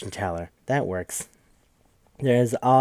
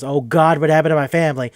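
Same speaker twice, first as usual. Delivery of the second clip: higher, louder horrified cry; mock horror; 4 semitones higher, 7 dB louder than usual. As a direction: gasp it out as if you've just seen your ruined home. Hero sees his wrecked home.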